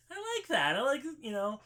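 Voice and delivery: squeaky high mock approval; mock delight. Friend mocking a villain's speech, squeaky high voice. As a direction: jump way up in pitch, teasing. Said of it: "And I'm like".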